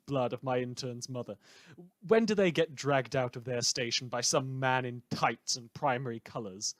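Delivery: smug, theatrical broadcaster sneering at his listeners; villainous radio host. Acted. A rogue radio host taunts his listeners about killing, in a smug on-air voice.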